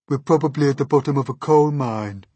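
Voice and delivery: loud, high-pitched worm moan; gloomy put-on character voice; gloomy earthworm. A narrator's reading. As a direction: predict the worst as the woeful earthworm. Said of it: "the earthworm said gloomily".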